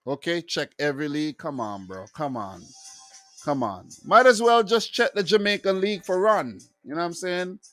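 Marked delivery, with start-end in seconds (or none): none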